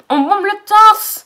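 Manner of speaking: high, loud and slow, chest out; defiant. Retold or imitated. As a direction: proclaim it proudly. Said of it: "je vais pas rester là".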